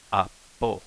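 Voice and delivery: "apo" with a held, silent stop before po; glottal stop. Shown as a demonstration. Teacher repeating the glottal stop for learners, "apo" broken by a silent catch. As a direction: say "apo" in two pieces, a catch between. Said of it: "had the little glottal stops".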